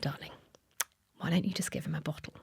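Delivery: mother's soft whispered advice; gentle whisper. Retold or imitated. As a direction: whisper it kindly to your exhausted daughter. Mum's gentle suggestion at night.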